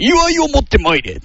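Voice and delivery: high hammed-up court command; mock lordly order. Mimicking a noble ordering celebrations, hammed-up. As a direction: summon the gifts like a feudal lord.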